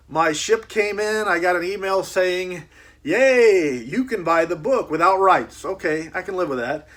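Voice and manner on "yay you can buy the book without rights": mock celebration. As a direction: read the email out like a cheering announcement. Acts out the good-news email.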